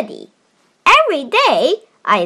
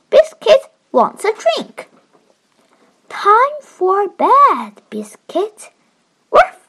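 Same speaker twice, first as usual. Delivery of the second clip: put-on reading to a toddler; 5 semitones higher than usual. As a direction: read it gently to a little one. Child reads picture book aloud.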